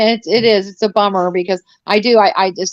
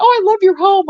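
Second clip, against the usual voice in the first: gushing fan; 12 semitones higher, 4 dB louder than usual. Retold or imitated. Mock gushing viewer quote.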